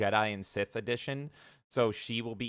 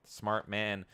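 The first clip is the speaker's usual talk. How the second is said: usual speaking voice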